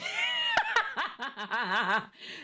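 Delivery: real whooping laugh; high shouted laugh. Real, not acted. Laughs at own story.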